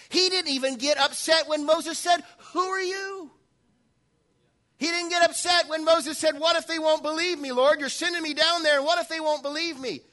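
loud, high preaching, Moses' questions pushed up; full-force preaching. Acted. Preacher proclaiming Moses' questions to the congregation, loud and high.

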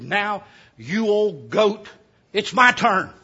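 slow, drawn-out demand from the battered rich man; slow vengeful demand. Retold or imitated. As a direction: drag out the threat as he hobbles up. Beaten man demands his turn.

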